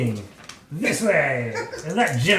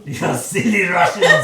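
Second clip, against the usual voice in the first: loud laughing taunt on silly; mock Python taunt, louder; 3 semitones higher, 10 dB louder than usual. Player repeating the Python taunt, loud and laughing. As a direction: jeer with a laugh. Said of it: "Monty Python".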